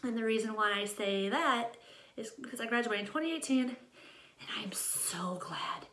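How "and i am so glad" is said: slow whispered confession; hushed aside to viewers. Real, not acted. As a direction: whisper it like a secret relief. Confiding aside about school.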